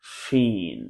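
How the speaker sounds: শিন said softly with a breathy sh sound; letter demo. Shown as a demonstration. Teacher models শিন so students hear its sound. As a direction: let শিন come out softly, the air rushing. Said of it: "এভাবে আওয়াজ করে আমি উচ্চারণ করি".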